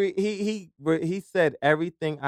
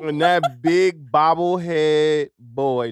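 very slow, drawn-out telling; slow dramatic; 7 dB louder, much slower than usual. Acted. Storyteller building to the slammed door, slow and dramatic. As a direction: stretch every word for drama. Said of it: "slowed for effect".

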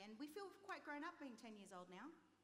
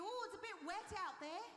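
polite Brit; 8 semitones higher, 7 dB louder than usual. Retold or imitated. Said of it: "If they say to you".

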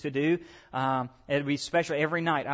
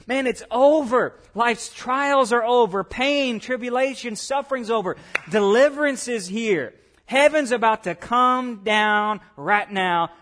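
loud, high, drawn-out exultation; slow, triumphant shout of deliverance; jubilant declaration; 6 semitones higher, 6 dB louder, slower than usual. Acted. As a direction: proclaim relief, stretching the big words. Acts out jubilant worshiper's thoughts.

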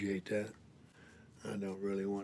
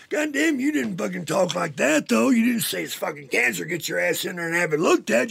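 much higher, loud furious comeback; angry retort; 9 semitones higher, 11 dB louder than usual. Retold or imitated. Telling the doctor he never said it was cancer, a loud furious yell. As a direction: yell back at the doctor in outrage. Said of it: "I said".

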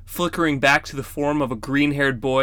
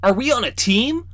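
put-on boy asking; 9 semitones higher than usual. A narrator's reading. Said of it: "Rawl asked".

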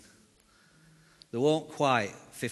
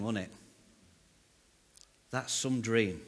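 ordinary talk

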